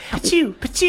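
put-on high squeaky baby sneeze; a cute little drone sneeze; tiny sneeze sound. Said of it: "sounds like a baby sneeze".

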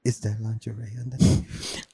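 low, slow, soft creepy murmur; creepy flirt. Retold or imitated. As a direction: leer in a hushed drawl. Voicing creep to mannequin.

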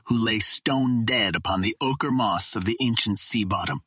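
ordinary delivery, nothing marked

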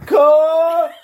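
high, loud yell of cuh; hammed-up loud call of cuh heard from the bathroom; retold yell. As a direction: shout it across the house like calling a friend. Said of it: "I heard".

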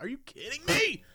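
high, soft squealed question; mock incredulity. Customer told of a long wait, high mock incredulity. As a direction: squeak it in disbelief. Reacting to forty-minute wait.

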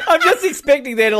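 high burst of laughter; laughing. Real, not acted. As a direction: laugh through the words. Laughing at the product plug.

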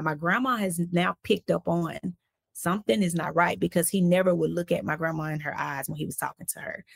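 ordinary talk, nothing marked

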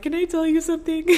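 high and loud, laughing and near tears; nervous teary giggle; tearful laugh. Retold or imitated. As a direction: blurt it out half laughing, half crying. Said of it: "I was like".

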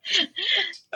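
brief, high-pitched laugh. Real, not acted. Co-host laughing about nosy neighbours, high amused laugh. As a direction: giggle at the gossip.

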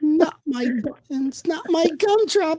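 high, slow squeaky pleading; mock-plead in a tiny cartoon voice; Shrek character. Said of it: "Just watched Shrek over the weekend".

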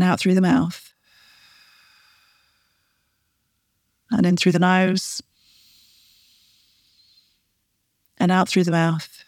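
calm, unhurried breathing instructions. Shown as a demonstration. Coach leading a breathing exercise, calm and unhurried. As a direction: guide the listener's breath gently. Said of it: "take a few nice deep breaths".

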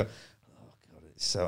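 breathy whisper of panic under his breath; muttered horror to himself; whispered dismay. Retold or imitated. Comic walking on stage, whispering to himself in dismay. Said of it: "and then I go".

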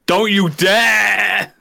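slow, high, loud mock warning; drawn-out shout. Heckling the award host with a drawn-out shouted warning. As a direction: stretch out dare as a big shouted threat.

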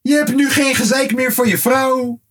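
high-pitched playful exhortation; mock pleading. Fan urging [name] to release an album, high mock plea. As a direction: plead up high, as if calling to him.

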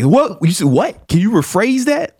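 high-pitched, disbelieving challenge; mock incredulous. Host retelling his challenge to a student, mock incredulous. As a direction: shoot it back in mock disbelief.

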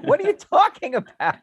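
disbelief. Retold or imitated. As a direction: squeal it through a laugh, incredulous. Band reacts in disbelief.

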